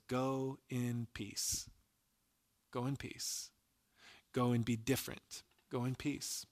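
slow, hushed, gentle send-off as Jesus; tender blessing. Retold or imitated. Jesus tenderly sends the woman off.

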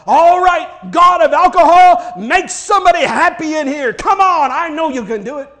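loud, high barroom proclamation; standing on a bar, mock-praying loudly to the god of alcohol; mock shout. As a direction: shout it like a fake preacher on the bar. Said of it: "to shout out".

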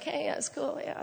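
faking casual. Retold or imitated. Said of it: "trying to be all cash".

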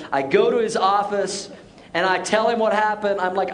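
ordinary talk, nothing marked

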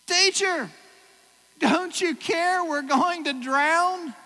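loud, high, slow cry of fear; high loud panicked cry to Jesus; panicked plea. Retold or imitated. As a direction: beg him as the boat sinks. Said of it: "I'm just saying what Peter said".

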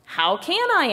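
high-pitched storybook objection; protesting retort. A narrator's reading. Body answers Head's scolding, protesting retort. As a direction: throw the blame straight back.

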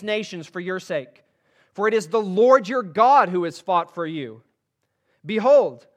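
loud, ringing preacher's reading of Scripture; emphatic scripture reading. Acted. Preacher reading Joshua's farewell to the church, loud and proclaiming. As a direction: proclaim it to the congregation.

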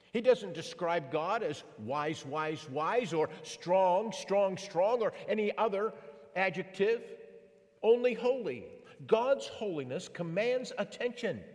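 rising preacher's fervor; building sermon emphasis. Acted. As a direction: drive the list home from the pulpit.

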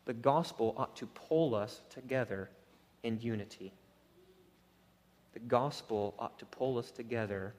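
low, soft, earnest appeal; hushed solemn preaching. Real, not acted. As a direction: drop right down and plead quietly with the congregation.

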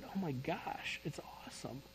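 a reverent, near-silent "it's awesome"; hushed awe. Retold or imitated. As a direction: let the wonder take your breath away. Awed reply during the vision.

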